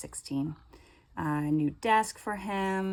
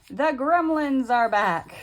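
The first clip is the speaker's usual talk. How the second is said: louder, slow announcement, higher; son's announcement; 7 semitones higher, 9 dB louder, slower than usual. Retold or imitated. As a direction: announce it loudly and slowly. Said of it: "the door and goes".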